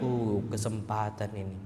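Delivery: very low, soft murmur; tempted aside. Acted. Person offered something forbidden sees a chance, tempted murmur. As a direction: say it under the breath, as if to yourself.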